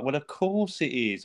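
pretended knowing. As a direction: draw it out as if you knew all along. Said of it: "obviously pretending he knows what he's done".

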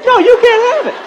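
fail to keep a straight face; laughing refusal. Retold or imitated.

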